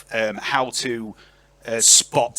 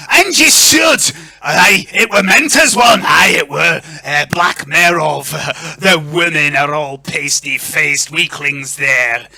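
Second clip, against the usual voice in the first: loud, raised-pitch Scottish lord, slowing down; broad Scottish accent; 4 semitones higher, 10 dB louder, slower than usual. Acted. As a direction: boom it out with a hammed-up Scottish burr. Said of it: "I apologise in advance for this accent".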